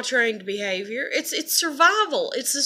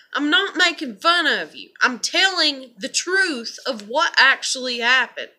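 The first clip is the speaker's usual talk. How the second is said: retold scolding; 2 semitones higher, 3 dB louder than usual. Parent correcting an embarrassed daughter, retold firm scolding. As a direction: scold your child with insistent stress, starting pitched up. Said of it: "like, scold her about that".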